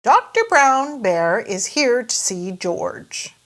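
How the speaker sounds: ordinary delivery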